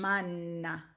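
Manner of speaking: manna with a held, longer N. Shown as a demonstration. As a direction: stretch the N in manna. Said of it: "the N is actually a little longer".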